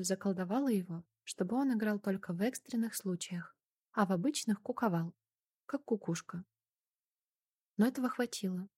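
ordinary delivery

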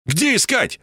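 high, insistent demand; urgent question. A narrator's reading. (требовательный вопрос повыше)

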